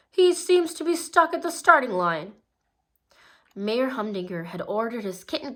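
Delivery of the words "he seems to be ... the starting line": loud, higher commentator voice; race announcer. A narrator's reading. Ron the announcer calling the stuck racer, loud. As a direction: call it like a track announcer. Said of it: "the announcer".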